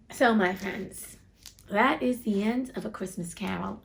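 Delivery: usual speaking voice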